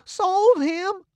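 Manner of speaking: high, loud disbelieving "Sold him?"; shocked cry. A narrator's reading. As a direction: gasp it out in shock. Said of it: "and begun to cry".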